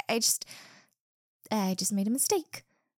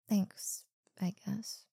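soft unvoiced whisper; 7 dB quieter than usual. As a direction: murmur the thanks shyly in a whisper. Whispering thanks.

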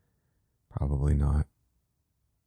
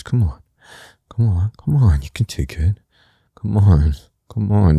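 insistent urging; 4 semitones higher, 5 dB louder than usual. Acted. Urging partner, growing insistent.

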